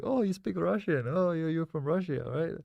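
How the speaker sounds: high, eager mock quiz of a Russian; mock naive question. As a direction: tease like a clueless player. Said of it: "So I was saying like".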